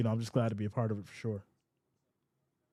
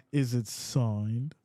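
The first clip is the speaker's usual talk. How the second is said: Is it signed dragged out low and slow; slow drawn-out question; 3 semitones lower, much slower than usual. Shown as a demonstration. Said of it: "That's how you gotta say it".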